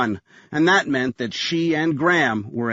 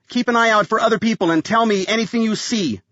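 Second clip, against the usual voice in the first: urgent order; 8 semitones higher than usual. A narrator's reading. Urgent orders during a shootout.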